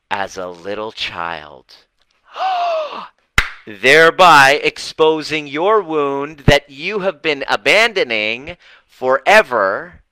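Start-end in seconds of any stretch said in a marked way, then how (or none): 2.3-3.1 dramatic gasp. Acted. 3.7-10.0 dramatic emphasis. Acted. Dramatic revelation.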